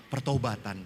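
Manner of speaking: normal talking voice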